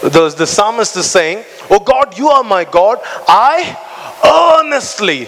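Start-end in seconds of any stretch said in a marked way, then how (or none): 1.7-5.2 rising higher on "earnestly", stretched out; fervent preaching. Acted. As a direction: climb up and draw out "earnestly". Preaching the psalm.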